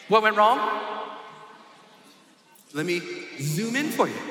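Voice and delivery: ordinary talk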